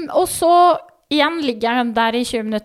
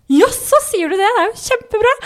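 much higher, bright mock midwife cheer; chirpy midwife; 10 semitones higher, 5 dB louder than usual. Midwife cheerfully praising the mother, high mock voice. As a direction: imitate the upbeat midwife, pitched way up.

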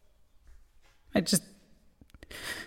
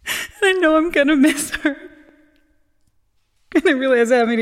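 sobbing, voice pitched up; crying; 5 semitones higher, 4 dB louder than usual. Acted. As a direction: cry through the words. Eulogy, crying.

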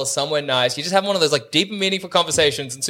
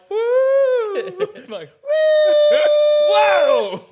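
very high loud woo; high whoop; 6 dB louder, much slower than usual. Retold or imitated. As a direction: squeal a silly high whoo. Imitating toilet sound, high whoop.

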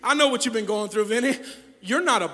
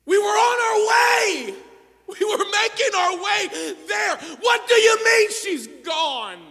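shouting; 11 semitones higher, 4 dB louder, slower than usual. Acted. Jairus's people bring bad news.

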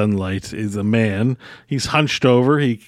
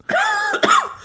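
harsh fake cough; coughing fit. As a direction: cough out the dust. Inhaled dust, character coughs.

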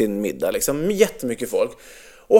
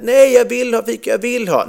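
put-on higher whiny child's protest; lightly voiced whining little boy; 8 semitones higher than usual. Dad imitating his son begging for a treat, higher and whiny.

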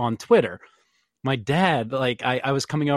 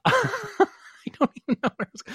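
laughing; 11 semitones higher, slower than usual. Real, not acted. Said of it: "Let me say it one more time".

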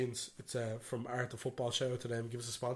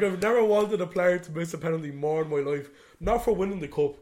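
loud and higher, fired-up fan rant; worked-up outburst; 5 semitones higher, 8 dB louder than usual. Retold or imitated. Irish fan recalls his reaction to the insult, loud fired-up rant. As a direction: let it rip like you can't believe it. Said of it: "I was like".

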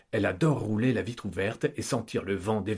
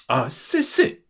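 wife cutting in; 4 semitones higher, 3 dB louder, slower than usual. Acted. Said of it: "les interrompt Kitry".

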